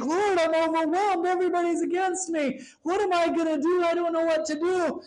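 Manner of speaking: high, rushed mock lament; high-pitched, overwrought complaint; overwhelmed David. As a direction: wail it as a panicked plea to the Lord. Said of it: "It sounded like".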